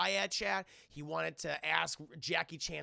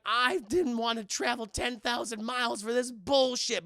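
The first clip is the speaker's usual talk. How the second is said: loud, higher complaint; mock aggrieved rant; 4 semitones higher, 8 dB louder than usual. Jackie Chan complaining after the fall, loud indignation. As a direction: declare it in hammed-up indignation.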